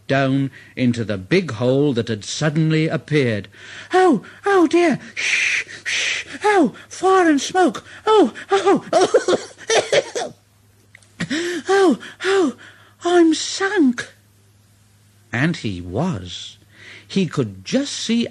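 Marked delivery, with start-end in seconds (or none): 3.9-14.1 high, panicky little engine voice; Thomas the little engine. Acted. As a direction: squeal it out like a frightened toy train. Thomas stuck in the hole.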